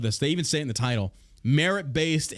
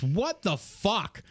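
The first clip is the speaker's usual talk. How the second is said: incredulous; 5 semitones higher, much slower than usual. Real, not acted. Disbelief at the news item.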